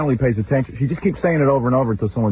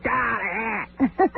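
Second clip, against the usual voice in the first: frustrated groan; 11 semitones higher, 6 dB quieter than usual. Real, not acted. Exasperated outburst.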